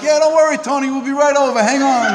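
high eager voice of the coyotes; mock coyote reply, high and bright; coyotes answering. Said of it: "they're like".

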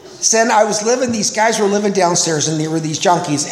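ordinary talk, nothing marked

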